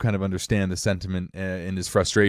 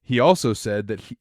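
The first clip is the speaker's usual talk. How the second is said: ordinary talk, nothing marked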